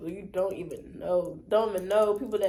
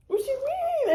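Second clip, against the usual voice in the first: much higher, eager; surprised question; 5 dB louder, slower than usual. Retold or imitated. Maya asking what happened, high and eager. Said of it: "she's like".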